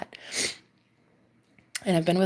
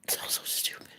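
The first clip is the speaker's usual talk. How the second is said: barely voiced, crying, much softer; tearful whisper; 10 dB quieter than usual. Real, not acted. Telling the camera about him, a real tearful whisper. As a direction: whisper it through tears. Emotional confession, near tears.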